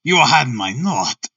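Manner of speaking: lightly voiced harsh German count; a touch of German accent, drawn out slowly; slow, slightly harsh German-accented question. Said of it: "harsh voice and a strongly marked German accent".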